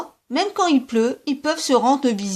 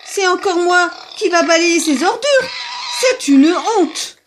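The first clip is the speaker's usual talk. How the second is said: higher, louder angry rant; angry rabbit; 7 semitones higher, 6 dB louder than usual. Acted. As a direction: rage at the messy neighbour. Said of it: "Le lapin brun se fâcha". (lapin fâché qui crie, plus aigu et plus fort)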